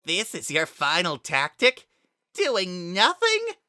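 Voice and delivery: high, loud mocking taunt; a villain jeering at a cornered foe. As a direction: sneer it out with scorn. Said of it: "Then he laughed".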